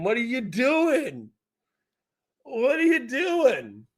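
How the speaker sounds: high, slow, breaking into laughter; laughing disbelief. Real, not acted. Streamer laughing at his guest's joke, high and slow. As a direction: stretch it out through helpless giggles. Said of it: "My mom's watching the stream".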